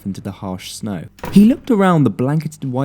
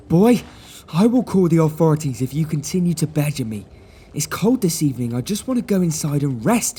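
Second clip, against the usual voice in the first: a lightly voiced, higher posh man, irritated; irritated gentleman; 6 semitones higher than usual. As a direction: threaten the boy with the authorities. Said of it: "he growled".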